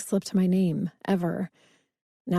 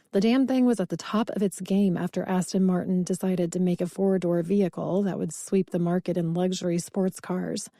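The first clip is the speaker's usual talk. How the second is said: ordinary delivery, nothing marked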